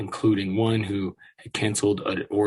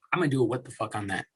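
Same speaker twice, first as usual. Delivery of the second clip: normal talking voice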